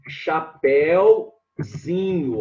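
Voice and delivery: "chapéuzinho" drawn out slowly in separated parts; syllable by syllable. Shown as a demonstration. Teacher forming the diminutive for students, slow syllable by syllable. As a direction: stretch each syllable of "chapéuzinho". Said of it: "vai ficar".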